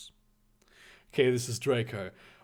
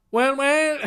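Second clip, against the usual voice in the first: put-on loud, high caricature Mexican accent; mock Mexican accent; 10 semitones higher, 5 dB louder than usual. Draco sputtering a comeback at Harry, mock Mexican accent. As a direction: bluster in a hammed-up Mexican accent. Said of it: "now he's Mexican again".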